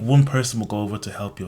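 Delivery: ordinary delivery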